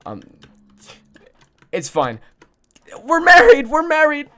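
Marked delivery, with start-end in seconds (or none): 3.0-4.3 excited shout. Real, not acted. Celebrating again.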